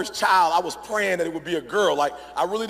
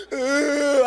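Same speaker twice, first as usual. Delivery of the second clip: higher wordless mock whimper; imitated sob; 7 semitones higher, 3 dB louder than usual. Daughter unable to name the boy, imitated sobbing. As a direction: mimic a child's hitching sob. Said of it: "my girl's crying".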